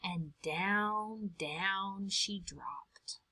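very slow unvoiced down, down; slow whispered drop. A narrator's reading. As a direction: let it sink away in a whisper.

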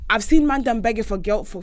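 normal talking voice

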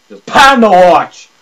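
loud drawn-out call; hyped shout. Real, not acted. Gamer calling out the panda again, loud hype. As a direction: yell it to the viewers.

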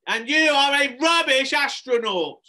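high, loud, drawn-out insult; furious child shouting; enraged yell. Acted. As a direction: scream it in a tantrum. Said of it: "Harrison really flew into a rage".

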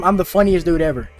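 normal talking voice

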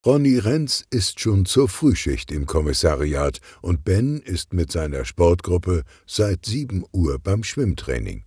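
usual speaking voice

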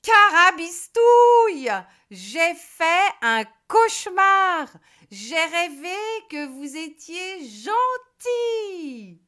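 very loud, high, slow witch voice; relieved witch. A narrator's reading. Witch waking and telling her animals about the nightmare, loud and slow. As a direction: groan it out after a bad dream.

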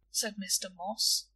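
ordinary delivery

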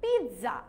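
পিৎজা as peet-za, with a t before the z; correct pronunciation. Shown as a demonstration. Teacher gives the correct answer, পিৎজা as peet-za. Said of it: "এটা correct answer is".

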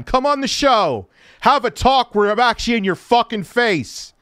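loud, high taunting call to someone gone; taunting yell. Real, not acted. Streamer yelling at a guest who left, taunting. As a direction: call him out, jeering and loud.

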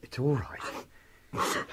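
slow, hushed comforting; soothing whisper. Acted. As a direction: whisper it to calm her. Comforting the sobbing housekeeper.

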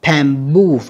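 "Bamboo" with BOO leaned on; second-syllable stress. Shown as a demonstration. Teacher showing students word stress, bam-BOO with the second syllable stressed. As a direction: hit the last syllable of "Bamboo". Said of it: "âm hai nha các bạn".

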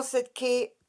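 ordinary delivery

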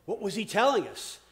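ordinary delivery

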